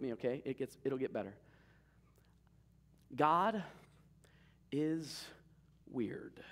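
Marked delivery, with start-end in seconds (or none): 3.1-6.4 slow and spaced, the last word dropping into a whisper; dramatic pause. Shown as a demonstration. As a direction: set up the punchline pause by pause. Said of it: "don't look at me weird because I called God weird".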